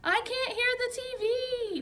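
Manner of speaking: high, slow, loud holler. A narrator's reading. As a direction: complain at the top of your lungs. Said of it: "Ty hollered".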